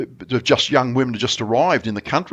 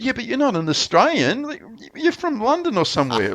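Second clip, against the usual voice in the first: much higher, mocking disbelief; scoffing challenge; 11 semitones higher than usual. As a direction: call him out as a Londoner. Narrator challenges the bloke.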